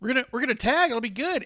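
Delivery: high, loud imitation of an excited schemer; eager pitching. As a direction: play him pestering a partner excitedly. Imitates Sammy eagerly pitching to Corbin.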